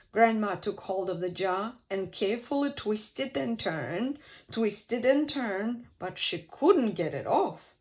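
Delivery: ordinary delivery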